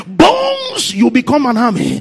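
loud, high, drawn-out declarations; full-force preaching. Acted. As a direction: preach it out loud, commanding each thing to live.

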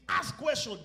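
ordinary talk

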